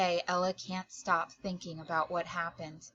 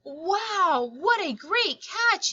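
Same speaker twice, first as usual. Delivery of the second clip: higher, amazed yell at the catch; yelling wow; 9 semitones higher, 4 dB louder, slower than usual. Acted. Kyle yelling in amazement at the catch. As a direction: shout it out, amazed. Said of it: "yells Kyle".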